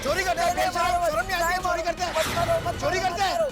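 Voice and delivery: heated, accusing scolding; angry scolding. Acted. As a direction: berate the thief at high pitch. Scolding while beating the thief.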